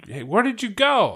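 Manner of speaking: parent calling out. Retold or imitated. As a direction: call out after the kid who left.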